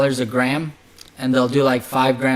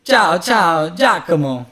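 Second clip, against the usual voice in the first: lilting, raised Italian accent greeting; louder, higher, playful Italian accent; 3 semitones higher, 4 dB louder, slower than usual. Shown as a demonstration. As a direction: put on a showy Italian accent. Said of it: "Italian accent, like".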